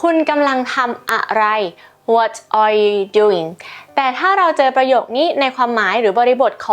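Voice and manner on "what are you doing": slow, careful teacher model; slow classroom demo; slow model reading. Shown as a demonstration. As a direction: draw it out for the class. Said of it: "ก็คือ".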